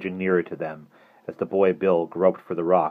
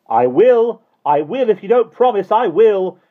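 put-on high, very loud child shouting; furious defiance; 11 dB louder than usual. A narrator's reading. Barney defies Mister Hastings's glare, furious shout. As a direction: shout it with passionate indignation.

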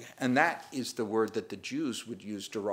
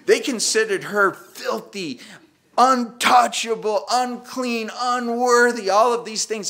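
higher and louder, slow and emphatic; rising preaching fervor; 10 semitones higher, 10 dB louder, slower than usual. Real, not acted. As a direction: pile up each insult with rising force. Preacher building on their contempt.

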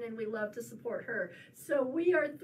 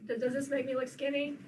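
ordinary delivery

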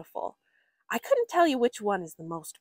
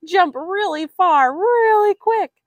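much louder and higher, laughing with glee; excited laugh; 10 semitones higher, 12 dB louder than usual. A narrator's reading. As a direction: bounce the words like a jumping frog. Excited laughing read of jumping frog.

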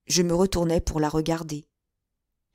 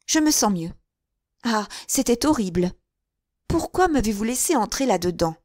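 put-on higher, reproachful girl's voice; a girl still upset after fainting; young woman half-blaming him; shaken young woman; 5 semitones higher than usual.

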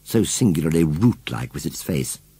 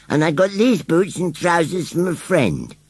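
unabashed rustic scarecrow; 10 semitones higher than usual. A narrator's reading. Scarecrow answers charge of stealing, unabashed. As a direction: play a scarecrow justifying himself. Narrator voices Gummidge the scarecrow.

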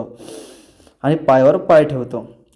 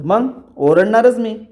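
higher, slow defiant declaration; a higher, slowed, defiant retort; defiant insistence; 6 semitones higher than usual. Retold or imitated. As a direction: lay claim to it, slowly and boldly. Kavya insists on her right to scold.

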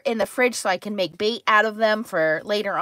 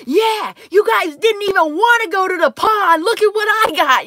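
high, loud bragging shout; teasing show-off call; gloating taunt; 8 semitones higher, 5 dB louder than usual. Retold or imitated. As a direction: rub it in to the guys hogging the lake. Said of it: "and I go".

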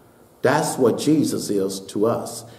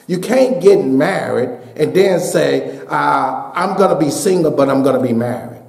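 raised, ringing pulpit voice climbing to shouts; preaching at full force; 9 semitones higher than usual. Acted. Pastor exhorting his congregation, preaching at full force.

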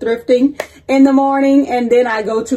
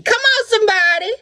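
high praise call; 9 semitones higher than usual. Real, not acted. Host rallies viewers like a preacher, calling high. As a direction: Come on, somebody called up high.

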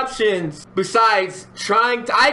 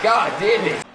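normal talking voice

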